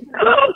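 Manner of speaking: put-on sharp high gasp of a child; shocked gasp. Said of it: "she said".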